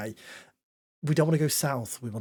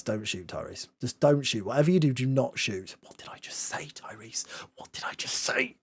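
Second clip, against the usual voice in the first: pleading whisper; 4 semitones lower, 4 dB quieter than usual. Pretend. Pleading with his own striker.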